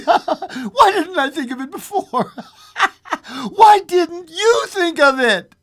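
high, laughing hysterical outburst. A narrator's reading. Wife realizing what they could wish, hysterical and high. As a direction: cry it high and loud between laughs. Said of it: "she said exteriorly".

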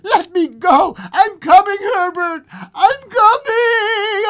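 high, loud shriek to someone far off; struggling cry. Acted. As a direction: cry out desperately, last word long drawn. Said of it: "she cried, struggling".

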